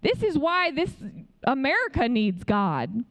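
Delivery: ordinary talk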